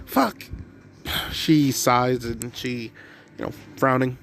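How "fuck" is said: a high, yelped little swear; flustered curse. Acted. Mitsuki catches her own slip in front of Izuku, high yelped curse. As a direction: blurt it out as you realise you've slipped.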